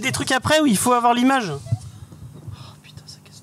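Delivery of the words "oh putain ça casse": whispered, exasperated swear; whispered curse. Real, not acted. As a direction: hiss it under your breath in frustration. Video breaks, curses to himself.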